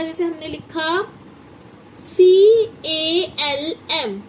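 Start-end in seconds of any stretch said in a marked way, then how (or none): 2.0-4.2 सी ए एल एम spelled very slowly and louder; slow loud spelling. Shown as a demonstration. Teacher spelling CALM slowly to children. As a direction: stretch every letter out. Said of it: "इसमें क्या है?".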